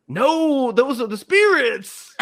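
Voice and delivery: loud, high-pitched imitated protest; mock insistence. Guide overrules talk of marsh vapors, mock outburst. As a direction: wail it in hammed-up exasperation.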